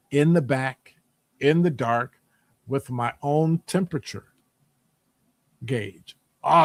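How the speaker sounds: ordinary talk, nothing marked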